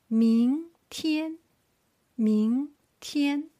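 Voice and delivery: 明天 with 明 rising and 天 high and level; second tone, first tone. Shown as a demonstration. Teacher modelling the first line, 明 rising, 天 high level. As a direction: stretch 明 upward, hold 天 high. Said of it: "Starting from second tone".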